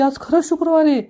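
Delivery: can't believe it's really Friday; shocked disbelief. Acted. Avni asking Yashoda, stunned it's really Friday, high-pitched disbelief.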